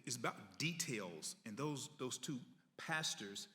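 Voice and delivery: ordinary talk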